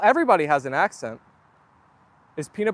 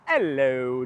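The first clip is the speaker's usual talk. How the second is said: low drawn-out greeting; 6 semitones lower than usual. Real, not acted.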